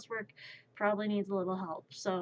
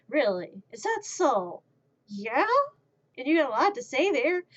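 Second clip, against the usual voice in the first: louder, higher mock-interested questions; playful entertaining; 5 semitones higher, 6 dB louder than usual. Owner answering meowing cats, playfully entertaining. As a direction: humour a pet as if it talked. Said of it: "I entertain them with the whole".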